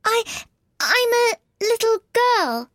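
put-on high, slow, halting girl's voice; falter, then offer it uncertainly; doubtful. A narrator's reading. Alice answers the pigeon's question, doubtful. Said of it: "said Alice rather doubtfully".

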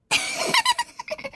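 high squealing giggle; little scream of laughter. A narrator's reading. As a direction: burst into a little shriek of laughter. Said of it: "a little scream of laughter".

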